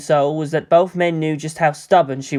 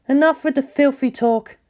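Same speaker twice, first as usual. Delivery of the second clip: higher woman's voice; 9 semitones higher than usual. Acted. Ritsuko scolding the pair.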